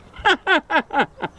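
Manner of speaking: high bright laugh bursting out; burst of laughter. Real, not acted. Laughing at his own joke about the missus. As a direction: crack up at your own gag.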